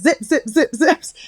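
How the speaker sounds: playful rapid-fire zipping; zippy imitation. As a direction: imitate a cartoon speedster whizzing by. Imitating Incredibles speedster zipping.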